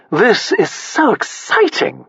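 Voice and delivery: high, slow, gritted-teeth gush; through clenched teeth. A narrator's reading. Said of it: "Sassy said through clenched teeth".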